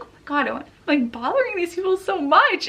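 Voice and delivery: mortified. Retold or imitated. Newcomer fearing she is bothering people, mortified. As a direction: recall fretting at being a burden, rising on "so much". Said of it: "I was like".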